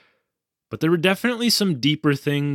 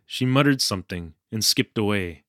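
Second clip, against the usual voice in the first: ordinary delivery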